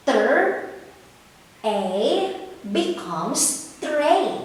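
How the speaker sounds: slow sounding out, then "tray" said whole; blending sounds. Shown as a demonstration. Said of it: "What is the name of this object".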